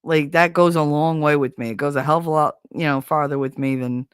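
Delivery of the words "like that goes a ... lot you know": ordinary talk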